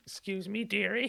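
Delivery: put-on high, soft old-lady voice; old dearie. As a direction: pipe up softly and high, like a polite old woman. Playing old woman calling out.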